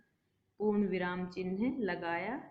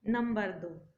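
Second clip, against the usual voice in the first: ordinary talk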